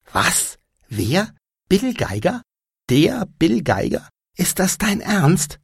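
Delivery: high, astonished questions; suddenly excited. A narrator's reading. Anja learns a film star is nearby, excited disbelief. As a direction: gasp out each question in rising disbelief. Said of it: "Plötzlich war sie ganz aufgeregt".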